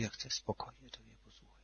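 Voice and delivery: put-on very soft breathless child's whisper; sick little girl whispering to her father, very soft; weak whisper. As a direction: barely get the words out. Sick daughter speaks weakly.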